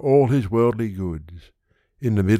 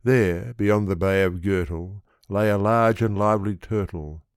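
plain reading voice

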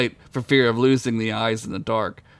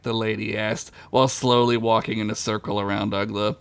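ordinary delivery

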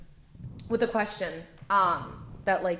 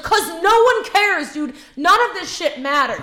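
angry; 8 semitones higher, 8 dB louder than usual. Retold or imitated. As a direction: snap back at the teacher. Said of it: "actually mad".

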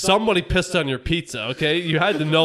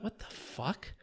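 hushed, breathy what the fuck; mock whisper of disgust; whispered quote; 18 dB quieter, slower than usual. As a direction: whisper it like a stunned guy walking in. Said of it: "He's like".

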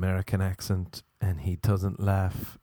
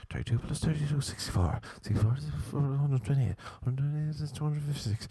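reenacted mental maths; 2 semitones higher than usual. Man doing maths in his head to hold off, quickening. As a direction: start measured, then race through the sums under your breath. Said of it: "I'd be like this".

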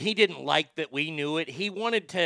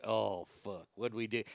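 dread under the breath; 6 semitones lower, 8 dB quieter than usual. Retold or imitated. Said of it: "you're like".

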